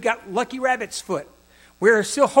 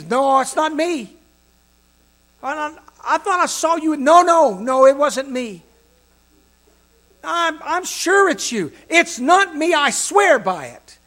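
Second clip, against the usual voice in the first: frantic, rising denial, louder and higher; panicked denial; 7 semitones higher, 8 dB louder than usual. Acted. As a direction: Peter denying in a panic, voice climbing. Peter denies knowing Jesus.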